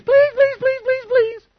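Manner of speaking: very high pleading whine; whiny begging. Retold or imitated. Preacher mimics the enemy's begging, high whiny plea. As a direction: wheedle in a shrill begging voice. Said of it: "it's the enemy".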